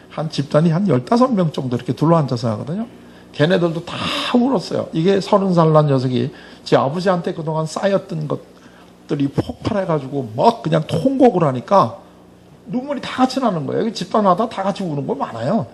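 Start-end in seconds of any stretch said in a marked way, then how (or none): none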